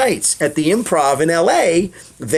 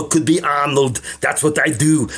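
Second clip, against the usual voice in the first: Arnold impression. Shown as a demonstration. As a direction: deliver it like a muscle-bound action hero. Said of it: "I pepper it with all impressions".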